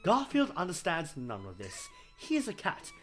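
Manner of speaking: normal talking voice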